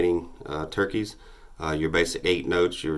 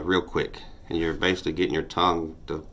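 ordinary talk, nothing marked

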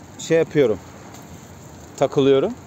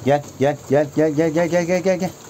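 eager coaxing call, climbing higher; calling the pigeon; 4 semitones higher than usual. Real, not acted. Keeper coaxing his pigeon into the coop, eager rising call. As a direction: coax the bird in, rising eagerness.

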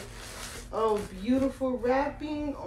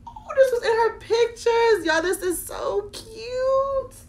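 happy tears; 10 semitones higher than usual. Real, not acted. Finds outfit from the pictures.

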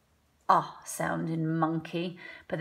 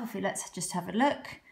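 ordinary talk, nothing marked

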